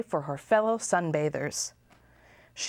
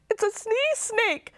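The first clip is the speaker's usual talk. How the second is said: sobbing. A narrator's reading. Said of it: "sobbed Lulu".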